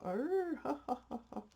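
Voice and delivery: high rising crow breaking into a chuckle; laughing hen crow. Retold or imitated. Owner imitating her hen's attempt to crow, laughing crow. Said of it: "she sounds like she's laughing".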